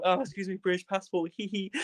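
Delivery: high, softened excuse-me voice; sheepish polite request. Retold or imitated. Timidly asks guards through swarming crowd.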